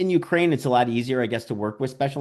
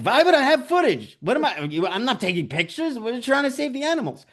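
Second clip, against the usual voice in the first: high, indignant imitation of Svetlana; mock exasperated woman; 13 semitones higher than usual. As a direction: squeak out her disbelief at the idea. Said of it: "She's like".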